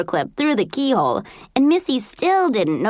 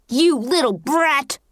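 slow, raised-pitch angry snarl; 4 semitones higher, slower than usual. A narrator's reading. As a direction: snarl it slowly in contempt. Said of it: "Missy snarled in my face".

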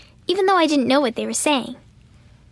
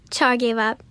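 ordinary delivery, nothing marked

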